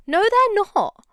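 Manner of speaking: loud, high, indignant No; emphatic shout. Real, not acted. Vlogger snapping back at moth defenders, loud indignant shout. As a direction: shout it down, no arguing.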